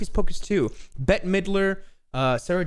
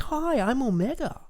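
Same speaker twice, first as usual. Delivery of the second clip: put-on Australian accent; 10 semitones higher, slower than usual. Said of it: "she has an Australian accent".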